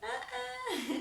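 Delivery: high giggly "uh-uh"; giggling refusal. Retold or imitated. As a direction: laugh a playful no. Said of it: "like how we used to respond".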